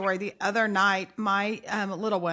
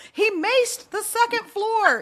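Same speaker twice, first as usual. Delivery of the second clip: much higher, louder and slow, frantic announcement; frantic report; 10 semitones higher, 6 dB louder, slower than usual. Retold or imitated. Mom telling husband the boy maced upstairs, frantic. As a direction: blurt it out in panic.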